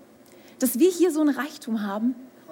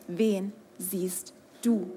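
soft, drawn-out question, much quieter; quiet slow question; 6 semitones lower, 6 dB quieter, much slower than usual. Acted. Preacher asks the congregation, slow and soft. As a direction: lean in and ask it slowly and softly.

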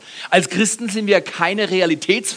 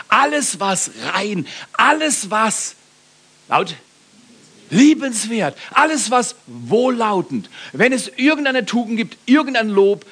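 emphatic scripture reading; 5 semitones higher than usual. Acted. Reads Bible passage emphatically.

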